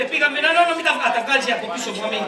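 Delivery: ordinary talk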